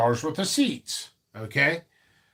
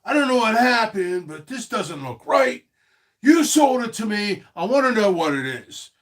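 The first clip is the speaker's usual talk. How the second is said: higher, louder complaining customer, lightly voiced; upset customer; 6 semitones higher, 3 dB louder than usual. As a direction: play a fed-up buyer who feels cheated. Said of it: "this is how customers come to me".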